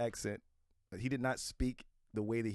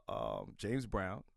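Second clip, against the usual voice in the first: ordinary talk, nothing marked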